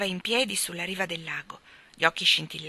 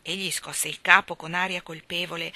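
ordinary delivery, nothing marked

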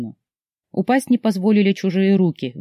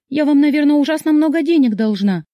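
very high, louder anxious cry; alarmed; 10 semitones higher, 4 dB louder than usual. A narrator's reading. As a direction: panic about the debt. Heroine fears repair cost.

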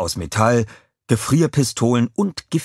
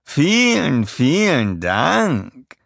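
high, cooing thanks; breathy vintage purr; 7 semitones higher, 3 dB louder, much slower than usual. A narrator's reading. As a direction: coo it like a diva. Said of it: "säuselte sie".

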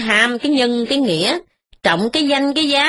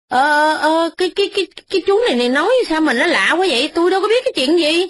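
higher, fast, stumbling over every word; flustered stammering; 5 semitones higher, faster than usual. Acted. Young master caught by Ba Cam, stammering his denial. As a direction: caught out and scrambling to deny it. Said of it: "Cậu Hai Nghĩa quá bối rối".

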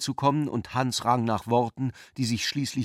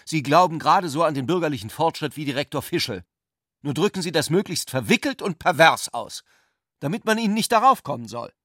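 fast, high and louder, gathering speed; heated accusation; 5 semitones higher, 4 dB louder, faster than usual. A narrator's reading. Hans Sepp rushes his attack at Ulrich, high and fast. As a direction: hurl the charge at him.

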